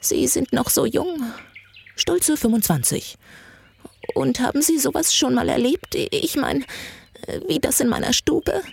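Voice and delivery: put-on high, frail, hesitant old lady; anxious old woman, pitched well up; brittle, frightened old woman. A narrator's reading. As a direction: question timidly in a cracked, high old voice. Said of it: "Ihre Stimme war so brüchig ... völlig verängstigt".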